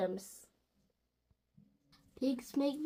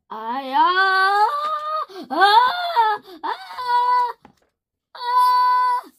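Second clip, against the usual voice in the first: high, loud wailing ah before a sneeze; hammed-up sneeze build-up; 8 semitones higher, 10 dB louder, much slower than usual.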